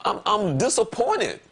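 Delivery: high-pitched, drawn-out disappointment; slow down and protest how let down you are; disappointed. Retold or imitated. Man retelling his reaction to a friend's news, disappointed. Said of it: "and I'm like".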